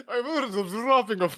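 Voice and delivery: impression collapsing into laughter; broken Connery voice, laughing; laughing through impression. Real, not acted. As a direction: try the Connery line but crack up. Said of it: "I can't even do the fucking impression anymore".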